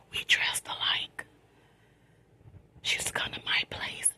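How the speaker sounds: soft late-night whisper; quiet at night. Real, not acted. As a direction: whisper so nobody wakes up. Late-night storytime, hushed.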